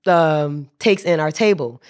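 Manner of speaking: ordinary talk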